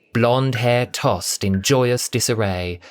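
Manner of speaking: ordinary delivery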